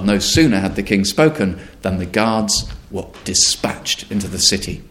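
usual speaking voice

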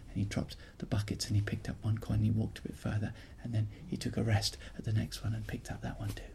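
soft unvoiced whisper. A narrator's reading. Storyteller hushing the tale of the sneaking boy. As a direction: tell it in a hush, step by step. Hushed narration of sneaking home.

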